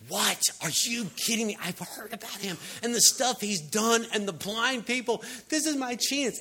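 high, loud, acted disbelief and excitement; excited beggar. Blind beggar hears Jesus is passing, high excited outburst. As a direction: burst out as if seizing your one chance.

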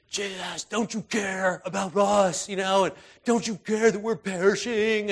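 high, loud desperate shout, slowed on the first plea; panicked cry. Retold or imitated. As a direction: cry out to Jesus as the boat sinks. Disciples crying out in storm.

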